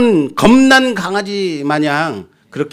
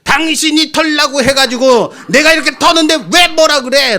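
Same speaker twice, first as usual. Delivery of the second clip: higher, hammed-up indignant retort, a bit loud; mock shouting back; 10 semitones higher, 3 dB louder than usual. Husband yelling back at his wife, mock indignant shout. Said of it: "큰 소리 치는 거죠".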